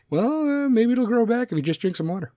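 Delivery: high, fast mock sergeant brushing it off; lightly voiced sarge who has only one answer; dismissive sergeant. Sergeant telling a soldier to drink water, mock offhand.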